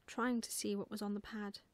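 ordinary delivery

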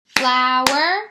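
"Flower" loud in two beats, FLOW, ER, rising; split into syllables. Shown as a demonstration. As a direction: stretch "Flower" into two syllables, tune lifting. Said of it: "Did you hear two syllables".

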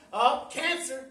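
ordinary talk

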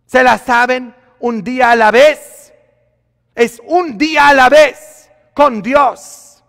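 loud, high preaching cry; fiery sermon delivery; full-force preaching. Acted. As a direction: preach it out to the whole church.

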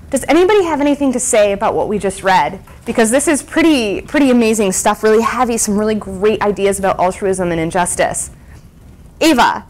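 projected, loud classroom question; loud teacher voice. Acted. Teacher asking class about the reading, loudly projected. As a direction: project to the back of the room.

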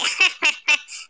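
staccato mocking laugh; villainous chuckle. Hyena mocking Simba's father, villainous chuckle. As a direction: laugh at your own jibe.

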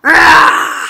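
loud pretend bear roar. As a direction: roar like a big storybook bear. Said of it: "Can you give me a bear roar?".

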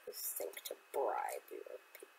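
low, sinister whispered taunt; villain whisper. Pretend.

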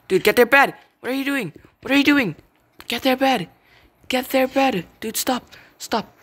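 high, loud shouts at a teammate; exasperated high-pitched gamer yell; urgent yelling. Real, not acted. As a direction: yell frantic orders at your teammate. Urgently ordering a teammate in game.

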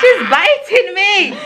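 high, slow protest through laughter; drawn-out giggly mock complaint; laughing complaint. Real, not acted. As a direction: squeal it out, amused at being bitten. Baby playfully biting her.

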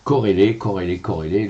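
Corrélé drilled loud, each syllable over-articulated; corrélé repeated, the first one loud and rising; practising pronunciation. Shown as a demonstration. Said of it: "Vous m'avez nature cash".

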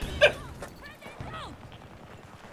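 high laugh. Real, not acted. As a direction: shriek with laughter. Laughing at the chaos.

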